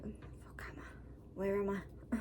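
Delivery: groggy confused whisper. Retold or imitated. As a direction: whisper like just waking up. Waking confused in recovery.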